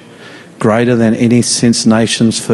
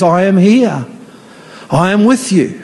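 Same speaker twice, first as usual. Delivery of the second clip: higher, building preaching call; rising fervour; 6 semitones higher than usual. Acted. Preacher building to God's promise, higher and fervent. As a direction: lift the promise with urgency. God says.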